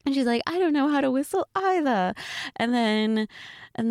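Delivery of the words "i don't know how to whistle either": high, quick mock British cartoon voice; fake accent. Repeating the friend's line after being teased, fake British accent. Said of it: "I'm bad at the accent".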